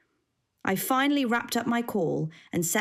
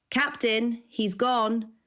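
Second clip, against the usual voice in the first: raised voice calling up the stairs; yelling upstairs; 5 semitones higher, slower than usual. A narrator's reading. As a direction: call out to the next floor. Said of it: "I yelled out".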